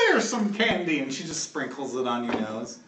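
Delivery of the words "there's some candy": high granny voice; old-lady voice pitched up high; grizzled old lady. Acted. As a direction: offer it like a doting old woman. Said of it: "she comes up to you and she's like".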